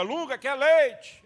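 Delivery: slow, high, loud asking voice; asking woman. Retold or imitated. Woman offering Lunga milk, slow and loud. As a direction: draw out the offer slowly. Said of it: "A mulher pergunta pro Lunga".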